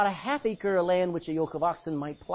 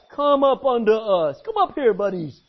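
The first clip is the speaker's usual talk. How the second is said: loud, slow taunting soldier voice; loud jeering challenge; mocking invite; 2 semitones higher, 8 dB louder than usual. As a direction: dare them to climb up.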